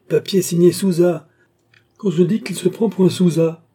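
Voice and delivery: high, loud delighted outburst; excited exclamation. A narrator's reading. Beausire mocking his partner, crying out high and loud. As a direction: cry it out, clapping your hands in glee. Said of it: "s'écria Beausire en frappant dans ses mains".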